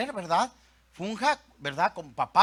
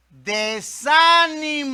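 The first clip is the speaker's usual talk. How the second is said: DESÁNIMO stretched long, high and loud; high, loud, very slow DESÁNIMO; climactic emphasis; 7 semitones higher, 10 dB louder, much slower than usual. Real, not acted. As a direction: draw out "desánimo" as the loud peak. Climax of Satan's list.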